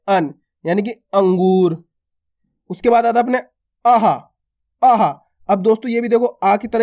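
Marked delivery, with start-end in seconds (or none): 0.1-1.8 अन and अंगूर spoken very slowly; drawn-out नासिक अन in अंगूर; slow demo. Shown as a demonstration. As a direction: spell out अन slowly. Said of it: "इसको कैसे पढ़ते हैं".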